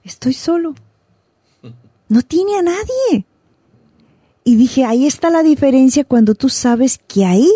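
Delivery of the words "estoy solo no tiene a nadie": higher, mock tearful voice of the neighbor; imitating the lonely neighbor. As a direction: lightly voice the crying neighbor. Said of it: "me lo encontré llorando caminando". (imitando al vecino solo, con voz más aguda y algo llorosa)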